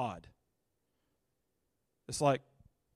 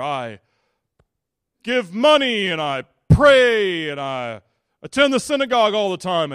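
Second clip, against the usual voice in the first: loud, boastful prayer, higher; proud Pharisee praying; 4 semitones higher, 9 dB louder than usual. Acted. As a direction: pray out loud like a smug Pharisee. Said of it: "he's saying something like this".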